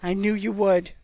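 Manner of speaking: understated, moved; choked up. A narrator's reading. Oliver to the Seeker who saved his season, choked. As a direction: say it with a touch of a choke. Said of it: "he choked".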